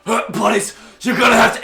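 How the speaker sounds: high, very loud challenge; security guard. Acted. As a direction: shout at troublemakers as a guard. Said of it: "Security comes over, like".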